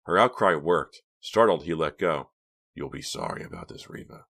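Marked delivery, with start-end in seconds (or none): 2.7-4.3 low, soft wounded threat; trembling. A narrator's reading. Hank threatens Reva after letting go, voice trembling. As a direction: threaten under your breath, mildly trembling. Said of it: "his voice trembling".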